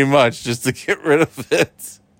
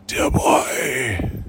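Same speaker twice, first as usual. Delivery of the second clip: Dumbledore voice; 7 semitones lower than usual. Pretend. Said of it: "And then Dumbledore".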